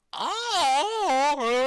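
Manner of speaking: high, loud, drawn-out ghostly wail; mimicked ghost-girl shriek; imitating her scream. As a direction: howl high and long like the creepy girl. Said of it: "That's what she sounds like".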